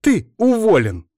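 high, loud female outburst; angry outburst. A narrator's reading. Stamping woman firing Bogdan, angry outburst.

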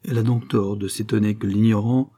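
usual speaking voice

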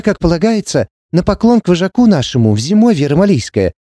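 high, rapid wheedling patter; chattering Romani girl. Acted. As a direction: rattle it off like a Romani hustler. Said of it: "затараторила я, копируя интонации одной из близняшек".

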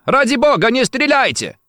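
high, desperate begging; panicked cry. A narrator's reading. Cesare begs the gunman not to shoot, crying out. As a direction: implore him, pitch right up. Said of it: "закричал Чезаре, вскакивая с кресла".

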